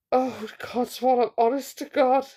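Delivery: usual speaking voice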